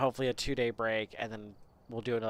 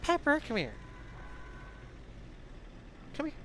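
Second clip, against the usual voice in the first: high sing-song call; calling the dog; 14 semitones higher, 4 dB quieter, faster than usual. Real, not acted.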